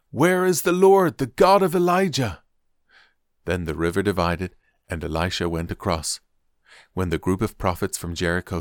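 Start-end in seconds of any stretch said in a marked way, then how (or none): 0.1-2.4 crying out. A narrator's reading. Elisha striking the river with the cloak, crying out. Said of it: "cried out".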